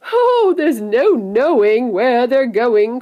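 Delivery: loud, high, drawn-out crowing; gleeful cry. A narrator's reading. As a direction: cry it out with hooting glee, no actual laugh. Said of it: "cried Mister Wonka, hooting with laughter".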